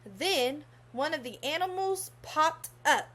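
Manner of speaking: ordinary delivery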